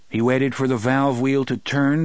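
usual speaking voice

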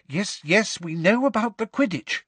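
high, tense impatience. A narrator's reading. Hermione to Angelina, tense and impatient. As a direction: cut her off tensely. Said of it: "said Hermione in a tense voice".

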